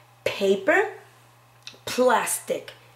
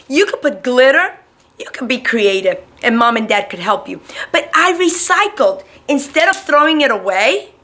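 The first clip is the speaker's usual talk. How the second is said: put-on louder, lively voice for little children; bright kids' show teacher; 2 semitones higher, 11 dB louder than usual. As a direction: sell it to a room of kindergartners. Teacher addresses kids on camera.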